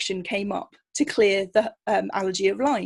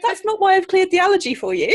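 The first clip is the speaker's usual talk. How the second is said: high, loud laughing disbelief; laughing protest; 10 semitones higher, 8 dB louder than usual. Retold or imitated. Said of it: "And I'm like".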